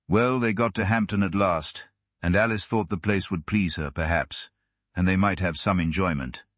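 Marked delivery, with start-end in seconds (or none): none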